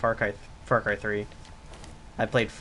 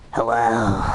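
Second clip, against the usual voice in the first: loud mic voice; 2 semitones lower, 7 dB louder than usual. Shown as a demonstration. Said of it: "so I can just be like".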